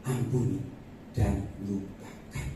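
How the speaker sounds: low, slowing voice of God; measured divine command, softening at the end; God speaking. Retold or imitated. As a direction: say it low and unhurried, with pauses. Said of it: "Tuhan katakan".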